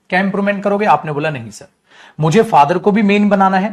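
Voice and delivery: ordinary delivery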